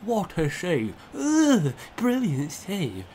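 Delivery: high, slow hammed-up rave; mock YouTuber gushing. Mocking a YouTuber gushing over saves. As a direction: overdo the praise like a hype commentator. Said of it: "M G H stuff where he says".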